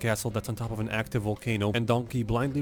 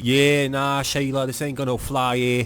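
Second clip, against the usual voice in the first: louder, put-on Australian drawl; Australian accent; 3 semitones higher, 5 dB louder than usual. Shrek refusing the princess a kiss, Australian accent. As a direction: turn her down in broad Australian. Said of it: "I'm doing an Australian accent".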